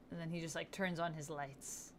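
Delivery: ordinary delivery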